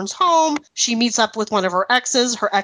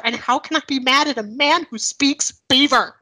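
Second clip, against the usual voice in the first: ordinary delivery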